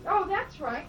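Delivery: ordinary talk, nothing marked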